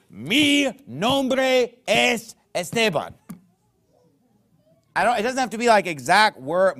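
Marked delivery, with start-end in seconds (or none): 0.1-3.1 "Mi nombre es Esteban." said very slowly, a bit higher and louder, with Spanish sounds; slow Spanish phrase. Shown as a demonstration. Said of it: "I don't have to say".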